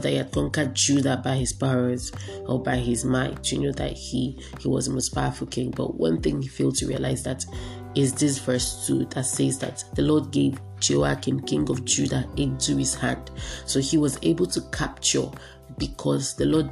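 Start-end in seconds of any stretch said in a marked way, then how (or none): none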